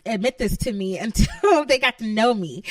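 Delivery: ordinary talk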